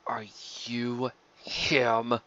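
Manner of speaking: slow, breathy whisper; slow threatening whisper; menacing whisper. Acted. Menacing stranger at door.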